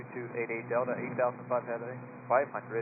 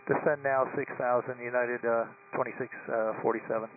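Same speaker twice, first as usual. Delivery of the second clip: radio-filtered cockpit readback; pilot over the radio; 7 semitones higher, 3 dB quieter than usual. Real, not acted. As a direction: read back the descent over radio.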